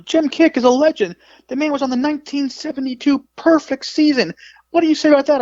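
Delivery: high-pitched imitated interviewer, challenging; indignant defense. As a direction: champion a legend and demand a response.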